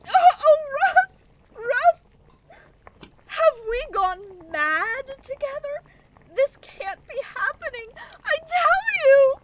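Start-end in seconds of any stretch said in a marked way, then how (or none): 0.1-2.2 loud, high distressed cries; distraught. Acted. Woman turns to Russ after a death, distraught. 8.2-9.3 loud, high, near tears; hysterical. Acted. Woman insists this cannot happen, hysterical. Woman denies what she saw.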